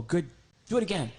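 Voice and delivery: usual speaking voice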